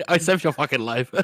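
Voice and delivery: high-pitched, through laughter; laughing mock outburst. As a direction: imitate indignation while cracking up. Mock quote amid laughter.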